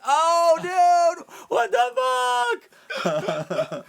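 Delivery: very high, very loud excited shriek; blown-away scream. Retold or imitated. Reacting to a friend's brand name reveal, astonished scream.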